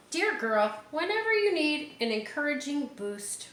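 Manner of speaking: high, tender storybook read; reading to children. Acted. Teacher reading the book's last page, high and tender. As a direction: end the book lovingly for the children. Reads picture book aloud.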